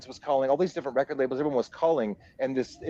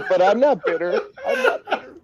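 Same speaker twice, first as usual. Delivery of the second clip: high, loud, laughing protest; real laughter breaking into the words; 6 dB louder than usual. Real, not acted. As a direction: say it through helpless laughter. Laughing at his own joke.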